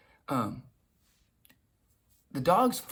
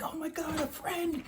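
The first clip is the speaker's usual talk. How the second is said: very high, giddy pup voice; imitated high-pitched delight; excited dog; 13 semitones higher, 4 dB quieter than usual. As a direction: play an overjoyed dog in a squeaky falsetto. Voicing his dog meeting another.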